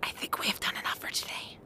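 quick urgent whisper. Acted. Woman whispering that it is time to leave. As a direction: hush it so no one overhears. Secret talk among the women.

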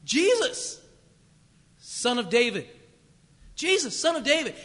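high, loud retold cry for help; yelling out. Blind beggar yelling to Jesus from roadside, loud and high. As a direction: call out to the passing crowd. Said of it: "he starts yelling out".

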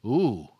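low, loud sympathetic wince; wincing ooh. Retold or imitated. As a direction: groan as if seeing a bad knock. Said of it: "which is just go".

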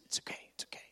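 soft voiceless whisper; imitating voiceless Bob reassuring. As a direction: whisper it calmly like a man with no voice. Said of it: "He goes".

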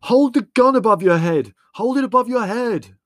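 high, very loud frantic roar. A narrator's reading. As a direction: roar an urgent order. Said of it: "roared Leslie".

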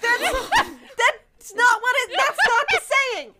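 high, incredulous laughing objection; amused outrage, half laughing; laughing protest. Real, not acted. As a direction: object through real laughter, pitch jumping up. Protests misquoted saying while laughing.

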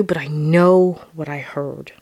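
ordinary talk, nothing marked

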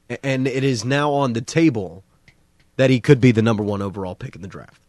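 normal talking voice

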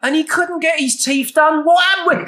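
high mock Cockney rant; exaggerated Cockney impression; Damian Lewis. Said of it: "I can do Damian Lewis brilliantly".

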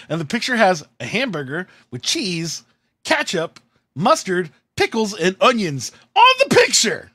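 indignant inventory rising to a yelp; exasperated listing. Retold or imitated. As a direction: build the list to an outraged "on the picture". Indignant retelling of the picture.

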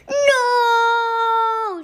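high, loud cry of despair; anguished shout. A narrator's reading. Miss Cuddy hearing Dirk School won, despairing cry. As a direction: wail it as your world ends. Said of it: "shouted Miss Cuddy".

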